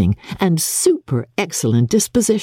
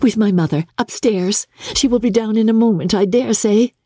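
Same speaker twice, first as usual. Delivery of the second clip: put-on higher, bright young sister; a young woman bursting with news; Jane's eager voice; 8 semitones higher than usual. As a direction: rush to tell her mother. Jane answers Bingley.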